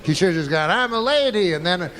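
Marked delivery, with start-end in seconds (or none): 0.7-1.5 pitched well up, mock declaration; mock proud announcement. Comic acting out what he should have said, mock announcement. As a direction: announce it high, in a put-on voice.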